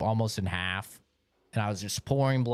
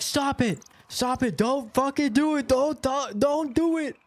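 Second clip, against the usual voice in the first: high, desperate begging, half-shouted; high panicked plea to a child reaching for a gun; frantic pleading; 12 semitones higher than usual. Retold or imitated. Pleading with kid in dream.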